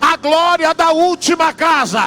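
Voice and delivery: loud, high, rising sermon climax; preaching at full force. Acted. Preacher shouting over the church about Mary's tears.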